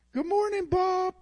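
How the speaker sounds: very high, loud parrot squawk; parrot greeting. Acted. Parrot waking [name] every morning, high squawky greeting. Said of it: "he'd wake up every morning".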